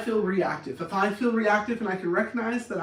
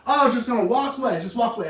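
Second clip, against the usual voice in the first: loud, higher jab at someone leaving; indignant taunt; mock indignant; 5 semitones higher, 7 dB louder, faster than usual. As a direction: act out a put-out partner's protest. Acts the person left behind.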